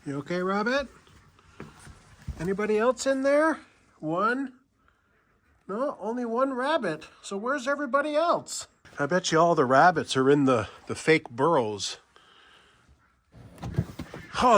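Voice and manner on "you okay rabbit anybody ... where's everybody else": pet-talk voice. Real, not acted. Cooing to the rabbits.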